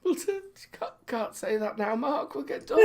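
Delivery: hushed, high, holding back laughter; trying not to laugh, softly; suppressed giggling. Real, not acted.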